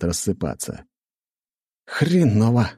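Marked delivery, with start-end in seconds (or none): 1.9-2.7 exclaimed. A narrator's reading. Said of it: "только и выдал я".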